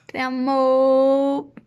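loud, drawn-out I love you; heartfelt. Real, not acted. A sister calling out her love for her brother. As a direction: stretch it out, full of feeling. Emotional birthday message to brother.

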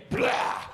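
rough, voiceless chomping blurt; gobbling noise. Acted. Comic wolfing down chicken, gobbling noise. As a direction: make a messy devouring noise. Acting out devouring the food.

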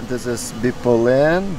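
ordinary delivery

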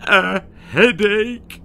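loud, high, slow wail. Acted. Mother Sissy hearing the news, loud high wail. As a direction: wail it in panic. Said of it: "the wail was Sissy's".

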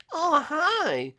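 very high, drawn-out hello; teasing falsetto greeting; mock-sweet greeting. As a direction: squeak it playfully on finding someone. Greets prey just found.

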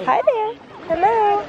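ordinary talk

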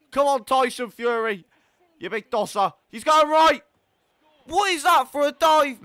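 loud, high-pitched berating; exasperated shouting. Real, not acted. Gamer berating his keeper after a dive, exasperated shouting.

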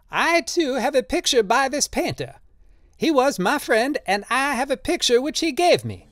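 very high cry at a contained volume; strained high mock shout; shouting in character. A narrator's reading. Old man calling out to the gallery, high-pitched cry. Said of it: "Drioli was shouting".

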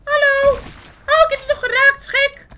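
high, loud put-on character voice; squeaky cartoon voice. Gamer talks to his opponent in a high cartoon voice. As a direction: chatter like an excited cartoon.

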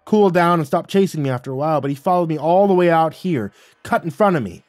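loud, high, agitated account; heated retelling. Acted. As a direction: spit it out, still worked up. Wally angrily recounting the chase.

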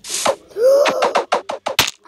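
loud high startled gasp; sharp gasp. Real, not acted. Gamer gasps as mob ambushes him. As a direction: gasp in sudden shock.

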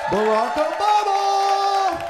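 loud high drawn-out announcement; announcer hype. Acted. Hyping the crowd for Obama, big loud announcement. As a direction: call him out like a ring announcer, slow.

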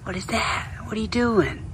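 high little whisper to a turtle; whispered to animal. Real, not acted. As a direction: gently whisper it to the creature. Talking softly to a turtle.

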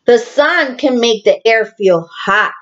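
usual speaking voice

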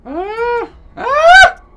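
a startled high-pitched squeak; sudden shrill-free yelp jumping up in pitch; strange squeal. Real, not acted. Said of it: "I'm making some strange noises".

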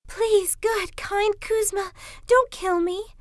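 high-pitched begging fox; pleading fox cry. A narrator's reading. Trapped fox begging Kuzma for its life, high pleading cry. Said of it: "cried the fox".

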